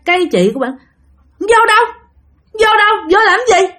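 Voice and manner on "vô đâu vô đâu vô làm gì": very high, balking retort; defiant refusal. Retold or imitated. Possessed girl refusing to go inside, defiant. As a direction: throw the question back stubbornly.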